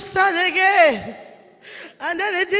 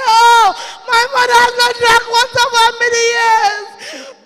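high, loud, tearful wail; crying lament; 8 semitones higher, 12 dB louder than usual. Retold or imitated. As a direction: wail it in grief. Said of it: "I say".

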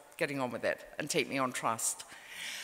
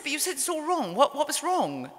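higher, urgent questioning; anxious pressing; 9 semitones higher than usual. Retold or imitated. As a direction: press her for an answer, pitch raised in alarm. Anxious author pressing his mother.

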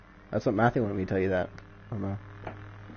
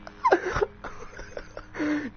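wheezing laugh; 6 dB quieter than usual. Real, not acted. Cracking up at game voice.

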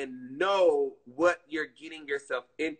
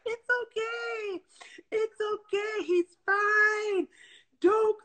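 much higher, slow soothing lady voice; slower than usual. Retold or imitated. Vet lady comforting the sobbing owner, high and soothing. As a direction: imitate the vet lady calming a crying owner. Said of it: "the lady was like".

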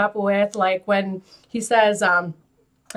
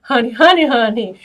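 sassy honey; 5 dB louder than usual. Retold or imitated. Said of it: "When he goes".

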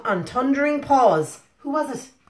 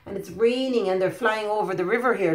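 plain reading voice

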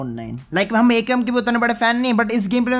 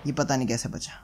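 much softer, lower aside under the breath; quiet mutter; 6 semitones lower, 7 dB quieter than usual. Real, not acted. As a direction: mumble it to yourself. Muttering aside about lucky escape. (धीमे से बुदबुदाना)

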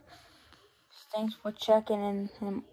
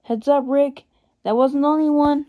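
loud urgent warning call; shouted warning; 5 semitones higher, 8 dB louder than usual. Acted. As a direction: yell across to Rick. Warning shout during attack.

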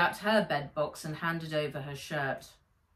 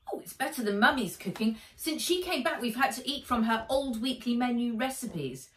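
put-on higher, quick chatty boy voice; Henry voiced higher and faster, full of chatter; boy cousin Henry; 4 semitones higher than usual. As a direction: gabble on like a cheerful greedy boy.

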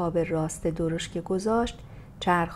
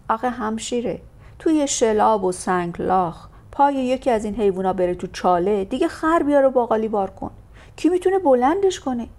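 loud, higher working man's protest; complaining coachman; 4 semitones higher, 7 dB louder than usual. Acted. Coachman complains to the lady about the muddy road. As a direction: plead your case, exasperated.

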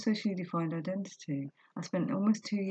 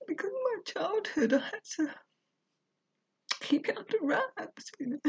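crying; 12 semitones higher than usual. Real, not acted. Crying about childhood joy.

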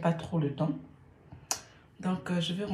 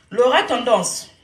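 loud, higher-pitched proclamation; raised voice, calling someone out; emphatic name call; 4 semitones higher, 11 dB louder than usual. Real, not acted. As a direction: belt out the name to open the topic. Restarts by announcing the target's name.

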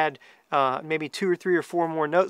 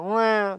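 a short high whining wah like a duck call; little whine; 5 semitones higher than usual. Shown as a demonstration. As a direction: imitate the whine on a duck call's third note. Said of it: "just a little whine to it".

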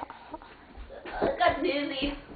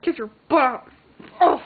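normal talking voice

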